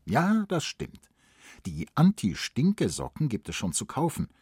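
usual speaking voice

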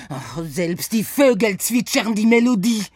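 höher, lauter, mit französischem Akzent klagend; verzweifelter Franzose. Acted. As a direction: gehetzt um Hilfe flehen, französischer Akzent. Saint-Saëns voice. (verzweifelt, französischer Akzent)

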